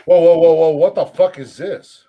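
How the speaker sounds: loud startled whoa; shocked. Real, not acted. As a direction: stop everyone in real shock. Shocked at a video.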